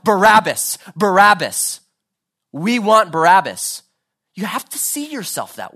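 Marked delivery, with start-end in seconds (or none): 0.0-3.8 loud, raised mob chant; crying out like a crowd. Acted. Preacher as the crowd demanding Barabbas, crying out. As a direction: shout it as one of the scoffers. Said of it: "shrieking crowd crying out".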